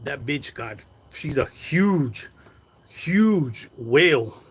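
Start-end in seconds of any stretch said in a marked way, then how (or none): 1.5-4.4 HUGE stretched slow, pitch swooping; slow, exaggerated stress on "huge, huge whale"; drawn-out emphasis. Real, not acted. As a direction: mockingly drag out each HUGE and WHALE. Mocking exaggeration of someone's size.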